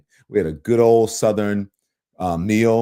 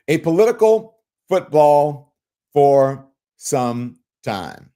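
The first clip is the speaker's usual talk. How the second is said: drawn out word by word; mocking echo; 3 semitones higher, 4 dB louder, slower than usual. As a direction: parrot the anchor very slowly. Echoes the news anchor's phrase mockingly.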